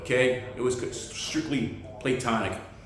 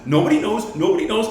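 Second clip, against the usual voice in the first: mock Mel Gibson; 10 semitones higher, 7 dB louder than usual. Imitating Mel Gibson's line.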